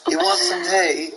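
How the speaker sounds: slow, high mock British; drawn-out British offer to the baby; put-on British accent. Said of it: "talk to her in a British accent".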